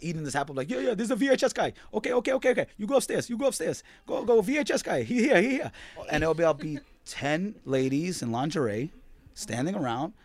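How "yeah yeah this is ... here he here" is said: put-on high, quick, excited old shopkeeper voice; imitating old man. Old peep show man ushering the VHS guy upstairs, high and quick. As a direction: do the one-toothed old man waving you upstairs. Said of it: "he'd be like".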